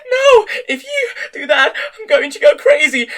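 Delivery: very high, loud, tearful cry; overwhelmed, crying out; shrieking. A narrator's reading. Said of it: "she let out a shriek".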